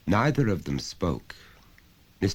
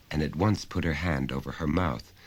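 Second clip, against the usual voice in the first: plain reading voice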